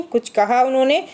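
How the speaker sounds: ordinary talk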